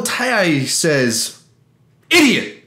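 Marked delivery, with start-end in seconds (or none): 2.1-2.6 loud, high mock insult; insulting snap. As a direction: bark it out like an insult. Reading a one-word insult.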